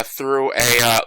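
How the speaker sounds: ordinary talk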